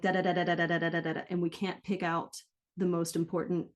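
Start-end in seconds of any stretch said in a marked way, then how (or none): 0.0-1.2 every "da" given the same weight, quick and unstressed; even syllable rhythm. Shown as a demonstration. Coach mimicking learners who stress no syllable. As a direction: tap out "da, da, da" flat and evenly. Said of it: "it ends up sounding like".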